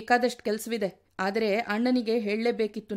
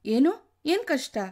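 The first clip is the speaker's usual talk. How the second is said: shocked; 5 semitones higher, 4 dB louder, slower than usual. A narrator's reading. Husband shocked in argument.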